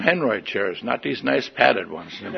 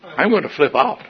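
higher-pitched, held-back retold exasperation; mounting frustration; 4 semitones higher than usual. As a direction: say it tensely without yelling yet. First, milder take of being ticked.

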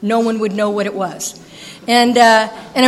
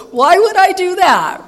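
indignant; 7 semitones higher, 6 dB louder, slower than usual. Retold or imitated. Indignant retort to sponsor.

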